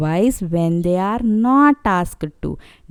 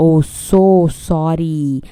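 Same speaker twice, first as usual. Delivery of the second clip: sorry bird; 3 semitones lower, slower than usual. Acted. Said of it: "That's how the birds felt".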